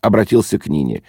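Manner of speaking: plain reading voice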